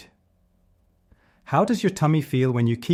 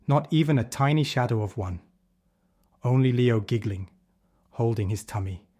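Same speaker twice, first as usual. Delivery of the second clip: plain reading voice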